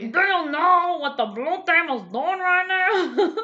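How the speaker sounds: high, loud, slow, laughing joke voice; replaying the inside-joke voice, high and giggling; silly joke voice. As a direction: push it up high and slow while cracking up. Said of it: "this voice comes from".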